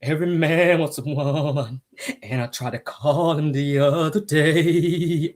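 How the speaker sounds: slow, drawn-out goat bleat; mock a tearful speaker with long wobbling vowels; billy-goat crying. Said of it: "there go that billy goat".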